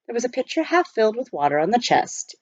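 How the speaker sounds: ordinary delivery, nothing marked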